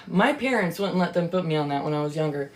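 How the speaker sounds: normal talking voice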